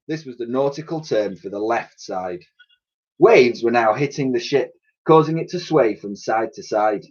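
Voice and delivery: plain reading voice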